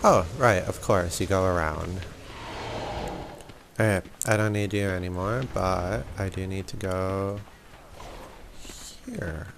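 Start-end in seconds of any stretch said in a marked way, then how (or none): none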